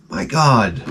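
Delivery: usual speaking voice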